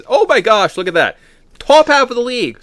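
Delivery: high, loud and delighted; thrilled to see them so high; real excitement at the standings; excited surprise. Real, not acted.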